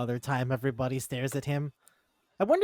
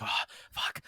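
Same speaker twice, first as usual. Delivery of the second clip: whispered dismay; 5 dB quieter than usual. Retold or imitated. Girl realizes his intent.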